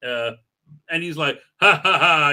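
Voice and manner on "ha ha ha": loud, hammy ha-ha-ha; exaggerated mock laughter; acted laugh. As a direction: fake a hearty character laugh. Acting out character's laugh.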